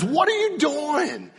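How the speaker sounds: Balaam yelps in shock at the donkey; startled Balaam. Acted. Balaam to his talking donkey, high and alarmed. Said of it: "And Balaam says".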